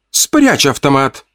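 high, slow, stern command; stern order. A narrator's reading. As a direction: order him sharply. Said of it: "строго приказала Лола".

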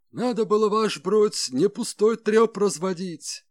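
high, loud, exasperated complaint; indignant outburst at a superior; through gritted teeth. A narrator's reading. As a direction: grit your teeth and protest hotly. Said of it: "скрипя зубами".

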